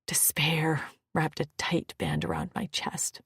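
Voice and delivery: ordinary delivery, nothing marked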